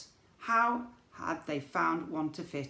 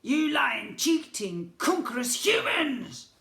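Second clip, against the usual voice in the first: high, loud, slow goblin rage; furious goblin rant; 10 semitones higher, 8 dB louder, slower than usual. A narrator's reading. Goblin prince raging at the humans, high and slow. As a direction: spit each insult out.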